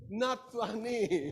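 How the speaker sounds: slow, high, drawn out into a laugh; laughing, stretched-out repeat; amused. Real, not acted. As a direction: echo it back, breaking into laughter. Amused echo of audience answer.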